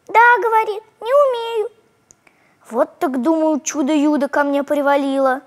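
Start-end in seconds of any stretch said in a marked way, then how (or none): 0.1-1.7 put-on high childlike voice; small girl answering shyly; little girl voice. A narrator's reading. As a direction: speak like a tiny girl.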